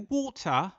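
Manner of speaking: mock-English "Water?" with the final r dropped. English local echoing his word as "Water?" without the r. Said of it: "made fun of the way I said "water".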